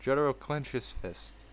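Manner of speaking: ordinary delivery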